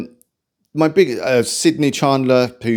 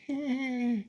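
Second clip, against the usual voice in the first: high laugh out of the voice; breathy laugh; 14 semitones higher, 13 dB quieter than usual. Real, not acted. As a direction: collapse into a laugh. Laughs at own impression.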